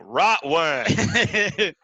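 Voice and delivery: laughing. Real, not acted. Laughing through a joke suggestion.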